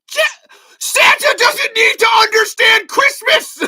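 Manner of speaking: high, slow exasperated shout through laughter; laughing shout. Real, not acted. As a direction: yell it, cracking up in disbelief. Heated rant about Santa.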